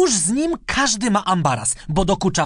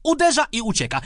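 ordinary delivery, nothing marked

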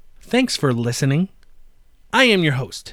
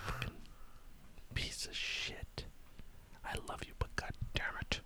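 whispered insult; 24 dB quieter than usual. Pretend. Mock insult to absent friend.

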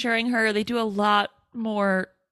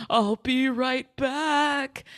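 slow, higher, drawn-out teasing call; mock taunt; 4 semitones higher, much slower than usual. Stu taunting friends as he leaves, teasing. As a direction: draw out each word to tempt fate. Voices Stu defying the rule.

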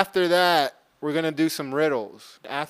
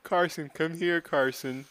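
calling out through laughter; giggling while calling a name; laughing; 3 semitones higher, 4 dB quieter than usual. Pretend.

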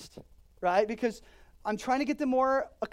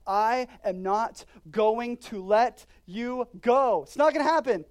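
God's firm promise; 2 semitones higher, 9 dB louder than usual. Acted. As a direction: promise it without a doubt. Heavenly Father speaks.

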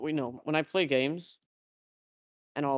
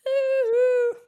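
high squeal; 11 dB louder than usual. Pretend. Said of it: "Me when I clean an oven".

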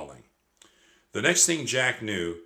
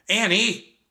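loud call, pitched high; calling for a missing sister; 12 semitones higher, 8 dB louder than usual. A narrator's reading. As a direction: shout her name across the house. Jack calls for missing sister.

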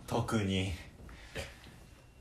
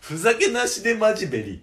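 gyaru speak; 9 semitones higher, 4 dB louder than usual. Pretend. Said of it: "なんでずっと昔なの？".

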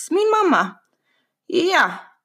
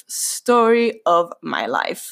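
slow, drawn-out tagline; slowly spelled-out punchline; emphatic catchphrase; 3 semitones lower, slower than usual. Real, not acted. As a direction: stretch out STORY and LIFE, wry.

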